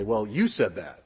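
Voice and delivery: pinning it back on someone; sour, faintly accusing well you said that. Shown as a demonstration. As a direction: turn the words into a reproach. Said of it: "That can be a term of negativity".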